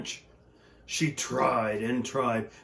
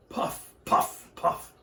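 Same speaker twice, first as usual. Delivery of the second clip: train puffing; slower than usual. Acted. Train puffing sound effect.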